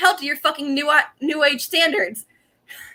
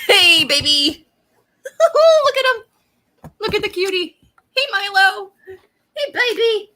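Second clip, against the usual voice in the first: high, slow cooing with laughs; 7 semitones higher, slower than usual. Real, not acted. Owner greeting her dog Milo on camera, high cooing baby talk. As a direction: coo at the puppy on screen.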